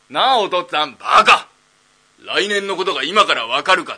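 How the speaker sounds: loud, high scolding yell; a father yelling down his son; angry shout. Acted. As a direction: bellow "baka" at him.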